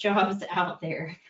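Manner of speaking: normal talking voice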